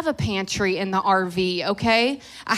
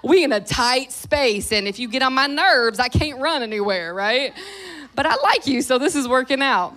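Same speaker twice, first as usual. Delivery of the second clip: raised, animated retelling to a husband; retold talk to husband; 4 semitones higher, 6 dB louder than usual. Wife telling husband she likes him in tight quarters, animated retelling. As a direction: build from fond admission to loud, higher protest. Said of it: "I said to my husband".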